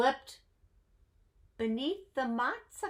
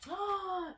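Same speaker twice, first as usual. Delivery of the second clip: sharp high breathless gasp; 10 semitones higher, 7 dB quieter than usual. Acted. Storyteller gasping at the chase, a sharp high intake of breath. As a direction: gasp in mock alarm.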